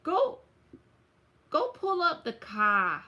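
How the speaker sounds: shaky attempt at a New York accent; Tyler. Attempting a friend's car line, in a badly done New York accent. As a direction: badly imitate New York speech, drawing out "Kai". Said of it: "I can't do that one too good".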